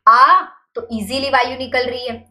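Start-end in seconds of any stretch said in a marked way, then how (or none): 0.1-0.4 आ drawn out openly, air flowing freely; आ demo. Shown as a demonstration. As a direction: let the आ flow out easily. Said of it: "जब स्वर बोलते हैं हम". (खुला खिंचा हुआ आ)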